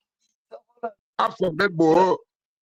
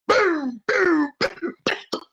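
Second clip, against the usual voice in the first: punch sound effects; 9 semitones higher, much slower than usual. Retold or imitated.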